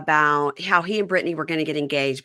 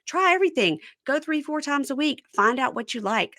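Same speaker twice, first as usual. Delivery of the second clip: excited push; 8 semitones higher than usual. Real, not acted. Urging listeners to try everything.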